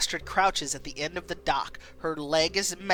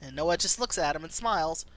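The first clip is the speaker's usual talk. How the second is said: ordinary delivery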